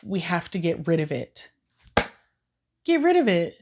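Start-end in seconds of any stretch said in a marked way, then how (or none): 2.8-3.5 higher, louder, disbelieving repeat; outraged disbelief at the sister; incredulous echo. Real, not acted. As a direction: throw the words back in shock.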